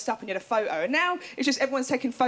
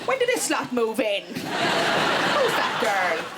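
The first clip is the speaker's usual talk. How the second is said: lightly voiced indignant nan; nan's outburst; 2 semitones lower, much slower than usual. Comedian as nan judging people on television, indignant. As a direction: blurt it out like an outraged nan.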